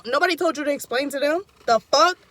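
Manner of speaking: angry retort. Retold or imitated. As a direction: snap at the friend explaining everything. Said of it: "So I get mad again".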